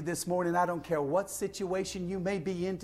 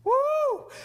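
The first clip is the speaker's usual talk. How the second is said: high, loud "woo"; excited whoop; 6 dB louder than usual. Real, not acted. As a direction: let out a preacher's joyful whoop. Preacher whoops mid reading.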